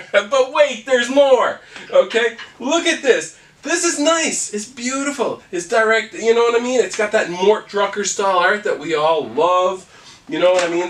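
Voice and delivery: high, loud hard-sell ad voice; infomercial pitchman. Acted.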